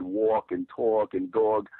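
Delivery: drawn-out New York vowels in "walk", "talk" and "dog"; New York accent. Shown as a demonstration. Showing how they said it as a little kid, New York accent on "walk", "talk", "dog". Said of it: "still has the New York accent".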